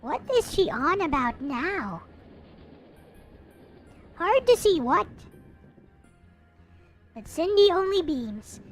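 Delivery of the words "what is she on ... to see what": usual speaking voice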